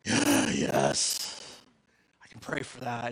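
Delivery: low, creaky, groaned yes; reluctant agreement. Retold or imitated.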